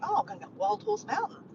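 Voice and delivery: high-pitched retold excitement; excited friend. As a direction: imitate an eager friend sharing plans. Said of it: "he was so excited".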